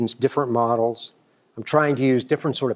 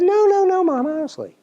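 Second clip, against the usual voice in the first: high protest; 4 dB louder than usual. Retold or imitated. Student protests to suspicious mom.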